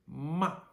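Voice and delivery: MA loud and high, falling fast; sharp falling fourth tone. Shown as a demonstration. Teacher models fourth tone without anger, MA falling sharply. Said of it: "This is the way that fourth tone is said".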